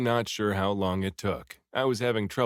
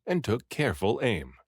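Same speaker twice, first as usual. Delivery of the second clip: ordinary delivery, nothing marked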